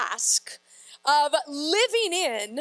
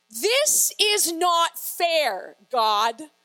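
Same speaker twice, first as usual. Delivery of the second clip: very slow, emphatic mock complaint to God; indignant protest; 3 dB louder, much slower than usual. Preacher putting a prophet's complaint in everyday words, drawn-out protest. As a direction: stretch each word out in protest.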